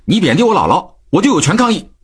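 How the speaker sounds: put-on high-pitched indignant child; angry protest. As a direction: protest angrily at your father. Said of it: "生气地说".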